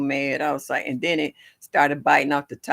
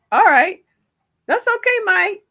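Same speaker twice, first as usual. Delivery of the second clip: excited; 7 dB louder than usual. Real, not acted. Reacting to viewer Mike.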